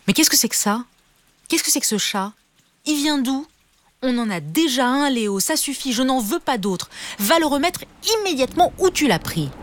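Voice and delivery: voix de maman plus aiguë, agacée; mère fâchée. Acted. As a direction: une mère qui gronde son fils. (mère en colère qui gronde)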